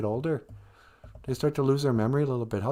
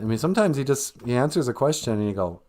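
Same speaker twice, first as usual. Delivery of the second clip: usual speaking voice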